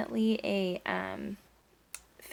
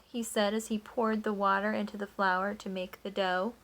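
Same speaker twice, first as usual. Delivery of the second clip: plain reading voice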